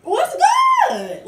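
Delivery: very high, loud taunting shout; challenging shout. Retold or imitated. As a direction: call her out, picking a fight. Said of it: "I pressed her ass again saying".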